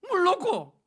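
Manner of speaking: high, loud, offhand mom's answer; mock mother. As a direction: fling the answer like it's obvious. 어머니 대답 흉내. (당연하다는 듯 큰 소리로 대답하는 어머니)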